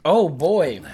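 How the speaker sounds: loud sarcastic praise, last line slow. Real, not acted. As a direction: praise it while plainly hating it. Ironic praise of matcha.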